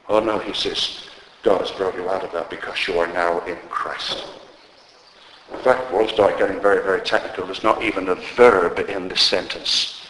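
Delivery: ordinary delivery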